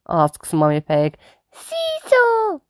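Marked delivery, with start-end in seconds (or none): 1.5-2.6 eager shout. Acted. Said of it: "shouts George".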